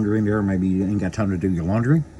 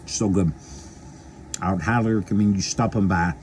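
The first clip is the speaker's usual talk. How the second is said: ordinary talk, nothing marked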